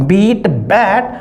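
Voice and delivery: बीट with long ee, then बैट with short e, slowly; slow vowel contrast. Shown as a demonstration. Teacher models the two forms for students, slowly and clearly. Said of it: "हम क्या बोलेंगे?".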